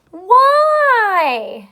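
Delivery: high and loud drawn-out why; loud long whine; loud exaggerated why. Shown as a demonstration. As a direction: belt out Why? like a whining kid. Emphatic model word.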